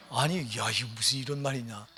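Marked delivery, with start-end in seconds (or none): none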